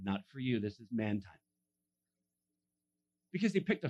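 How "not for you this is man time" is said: mock exclusion.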